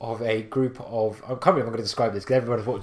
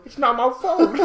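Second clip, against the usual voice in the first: high, whiny protest; defensive Leroy; 13 semitones higher, 6 dB louder than usual. Retold or imitated. Leroy denying blame to his raid group, a high whiny protest. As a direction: protest your innocence in a higher voice. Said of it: "you just hear Leroy Jenkins go".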